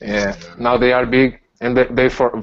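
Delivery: ordinary delivery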